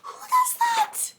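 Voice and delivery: high, climbing squeal of disbelief; incredulous shriek. Real, not acted. Reader yelling at the man with bare hand, incredulous shriek. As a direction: shriek it, drawing out the last words.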